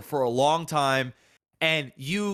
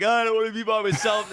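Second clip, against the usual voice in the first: higher-pitched mock whine of distress; mock crying; 5 semitones higher than usual. Reluctant partner crying about going on the show, mock crying. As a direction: imitate a tearful complaint, hammed up. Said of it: "the other couple's crying".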